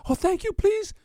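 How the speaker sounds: put-on little girl. Girl thanks the frog, high and eager. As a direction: beg in a tiny high voice.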